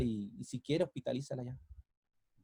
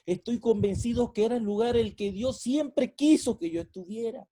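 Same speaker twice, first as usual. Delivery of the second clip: full-force preaching; 8 semitones higher, 8 dB louder than usual. Acted. Pastor proclaiming God's plan to the congregation, full-force preaching. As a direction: proclaim it to the hall.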